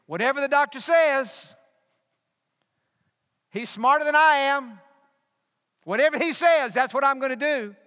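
high, loud voicing of blind trust; loud mock voice of blind trust in the doctor; mock deferential shout. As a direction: proclaim it like you'd hand over everything. Preacher voicing a deferential thought.

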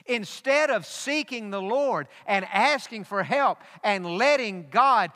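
full-force sermon climax; preaching. Acted. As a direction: drive the point home from the pulpit.

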